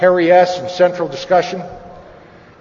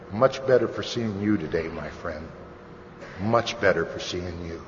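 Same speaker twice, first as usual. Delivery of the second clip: low, soft, sincere greeting; imitated tender reassurance; 9 semitones lower, 9 dB quieter than usual. Friend answering "how you doing", soft, low and sincere. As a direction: give it quietly, straight to a friend.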